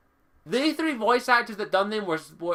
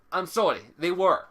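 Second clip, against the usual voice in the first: usual speaking voice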